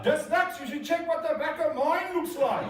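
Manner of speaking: loud high shout, laughing. Retold or imitated. Passenger shouts a joke back to the captain. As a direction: call out a cheeky joke from the back. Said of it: "shouted out".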